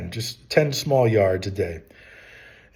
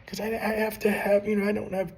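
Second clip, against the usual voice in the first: mock complaint in a higher voice; mocking young people; 9 semitones higher, 6 dB quieter, faster than usual. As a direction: imitate a whining objection, half under the breath. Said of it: "I shouldn't mock them".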